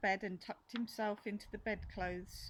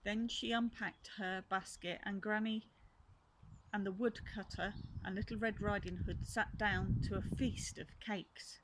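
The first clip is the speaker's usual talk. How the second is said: plain reading voice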